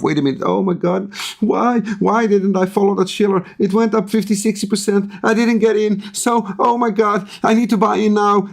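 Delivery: lightly voiced FOMO trader, a hint of panic; greedy inner voice. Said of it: "and you think to yourself".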